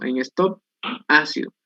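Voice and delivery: ordinary talk, nothing marked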